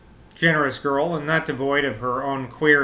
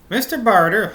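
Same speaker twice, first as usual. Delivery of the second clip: higher, louder Norwegian lilt; Norwegian accent; 6 semitones higher, 5 dB louder than usual. Acted. Maid names Mister Barter, Norwegian accent. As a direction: lean into the accidental Norwegian accent. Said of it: "I'm just gonna go with it".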